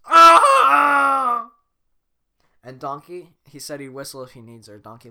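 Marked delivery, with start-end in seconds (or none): 0.0-1.5 high shrieking roar; mighty dragon roar. Acted. Dragon grieving over Donkey, shrieking roar. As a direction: let out a huge roar. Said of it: "lets out a mighty roar".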